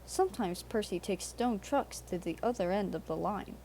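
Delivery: plain reading voice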